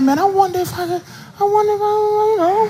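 high, soft scheming wonder; lecherous inner voice. Retold or imitated. Coworker thinking about groping, high hushed scheming voice. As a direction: float it up high and hushed, like a sneaky thought. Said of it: "you think in your head".

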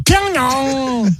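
"Pyongyang" stretched out high and slow; mock scream. Shown as a demonstration. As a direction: drag out "Pyongyang" in a hammed-up yell. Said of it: "they always scream it".

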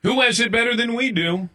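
high, rousing call to the crew; rallying call. Acted. Host leads the crew's catchphrase in a rallying call. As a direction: call it out for a chorus answer.

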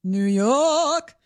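drawn-out New York accent on request. Shown as a demonstration. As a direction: stretch the vowel, New York style. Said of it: "she toned that one down".

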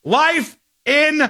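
high, slow, emphatic pronouncement; outraged verdict. Real, not acted. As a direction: hand down the sentence word by word, indignant. Outraged verdict on animal abusers.

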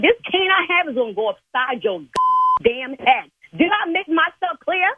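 high, heated threat; fed-up church lady threatening the caller; furious. Real, not acted. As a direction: let him have it, voice up high.

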